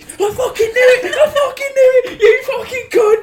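hammed-up furious scream, very high and loud; seething outburst. Co-host re-enacting the interrogator catching the fake Para, screamed in mock fury. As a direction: imitate an enraged instructor exploding, shrieking through laughter. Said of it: "'cause he's seething".

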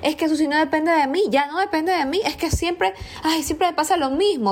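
vocecita aguda y quejumbrosa de víctima; quejido de víctima. Retold or imitated. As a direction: imitar a quien culpa a los demás, con voz alta y lastimera. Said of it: "es como súper rico decir". (voz aguda de víctima que se queja)

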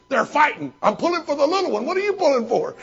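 high, loud, fast gleeful chatter; root for the little dog like it's a prizefight; visiting friend cheering a street dogfight, gleeful; excited friend. Retold or imitated.

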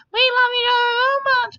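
very high, sugary put-on squeal; mock gushing lovebird. Mimicking Tana's post about her love, mock gushing. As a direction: gush it in a silly high voice.

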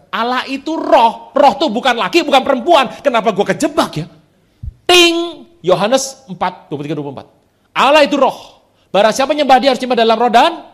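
loud, high proclaiming sermon voice; fiery pulpit declaration; preaching at full force. Acted. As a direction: hammer the truth home to the crowd. Preaching the revelation.

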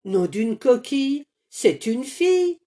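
disappointed cry. Acted. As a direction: cry out your letdown. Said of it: "s'écrie Carmelito terriblement déçu". (s'écrie, terriblement déçu, que c'est une fille)

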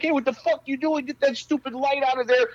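high, brash, put-on New York crew voice; loud New Yorker. New Yorker on set griping about the lights, brash. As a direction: bark orders like a pushy set guy. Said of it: "they're so loud".

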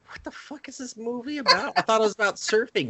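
high-pitched comic confusion; retold bewildered complaint; mock baffled viewers.

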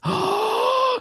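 high squeaky gasping inhale; tight gasp. Shown as a demonstration. Coach showing the sound to avoid: high gasp. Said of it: "you make that sound".

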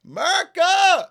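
high loud whooping "Merca!"; hammed-up eagle cheer; patriotic yell. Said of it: "was scream".

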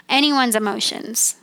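ordinary talk

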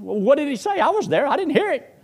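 high, loud incredulous questions; can't believe he missed it, pitched high and loud; baffled husbandly protest. Retold or imitated. Husband to wife, high and loud in disbelief.

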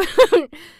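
short, loud high laugh. Real, not acted. Reader amused by the girl's bad job, real short loud laugh. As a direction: burst out in a quick high laugh.

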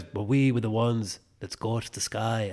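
put-on mild British accent as Morpheus; a light British Morpheus. Quoting Morpheus in a lightly British accent. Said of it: "gave him a British accent".

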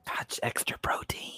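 whispered aside, breathy and hushed; mock confidential whisper. Waiter whispering excuses to a customer about the dirty booth, hushed. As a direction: let the waiter lean in and whisper it like a secret.

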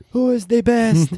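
high, slow, drawn-out heckle; imitated fan scream. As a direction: mimic a fan hollering from the crowd.

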